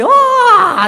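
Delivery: high, loud mock baby wail; imitated baby wail. Baby wailing with full effort for milk, mock wail. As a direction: cry like a baby giving it everything.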